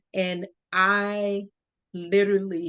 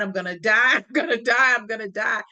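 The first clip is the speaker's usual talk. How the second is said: mock panicked wail; 4 dB louder than usual. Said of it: "and I'm crying".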